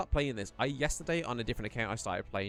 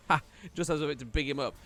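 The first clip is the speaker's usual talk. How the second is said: ordinary talk, nothing marked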